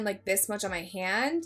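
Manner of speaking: ordinary talk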